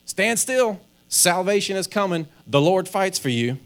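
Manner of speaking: high, loud, bold proclamation to the crowd; rally the people like a confident leader; Moses' public face. Retold or imitated. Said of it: "this is the public face that Moses is showing".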